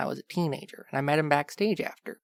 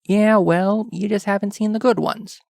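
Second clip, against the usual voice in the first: louder, higher defensive retort; indignant protest; 6 semitones higher, 7 dB louder than usual. Acted. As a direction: push back hard, half joking. Defending his movies loudly.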